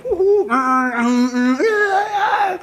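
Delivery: high cheering and horn noises; drawn-out crowd-and-traffic imitation; imitated whoops and honks. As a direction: mimic a street full of honking and hollering. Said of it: "But just kept going".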